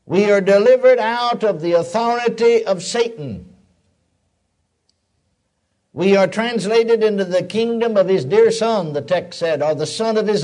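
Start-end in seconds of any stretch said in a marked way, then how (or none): 0.1-8.9 fervent preaching. Acted. Preacher proclaiming freedom from Satan to the church, fervent. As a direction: proclaim it to the congregation.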